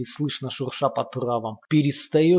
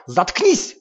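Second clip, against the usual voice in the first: higher, louder sharp shout; shouting back; 5 semitones higher, 7 dB louder than usual. A narrator's reading. Sasha yelling at Lomov to shut up, loud shout. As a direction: cut him off with a scream. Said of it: "кричит на него Саша".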